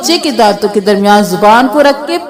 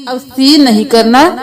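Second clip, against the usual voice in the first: usual speaking voice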